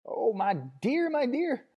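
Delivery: very high, slow tearful gush; overwhelmed, weeping with joy; high sobbing crone voice; sobbing old woman. A narrator's reading. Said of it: "the torrent of words flowed sobbingly".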